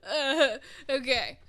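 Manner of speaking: tearful, slow okay through real crying; choked-up okay. Real, not acted. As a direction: pull yourself together through tears. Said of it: "I'm gonna cry".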